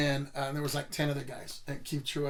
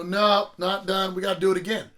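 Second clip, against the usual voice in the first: raised-voice retelling of a coach's command; imitating demanding coach; 3 semitones higher, 7 dB louder than usual. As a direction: call it out like a coach rejecting a drill. Imitates coach ordering a repeat.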